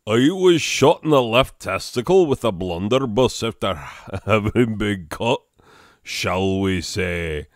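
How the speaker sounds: big rough British giant, breaking into a laugh; Hagrid. Acted. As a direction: play a hearty storybook half-giant. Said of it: "that's how I do Hagrid".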